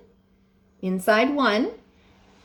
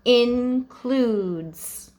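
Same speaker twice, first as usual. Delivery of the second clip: whispered word. Shown as a demonstration. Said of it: "let's read it with the suffix".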